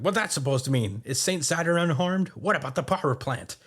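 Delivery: lightly acted demanding character, pitched high; indignant questioning. Admiral questioning Kizaru about the power plant, mock indignant.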